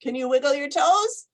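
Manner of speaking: usual speaking voice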